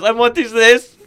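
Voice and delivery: high, loud naive question; Borat. Acted. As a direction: ask it in a put-on foreign accent. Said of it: "Borat asks what things are".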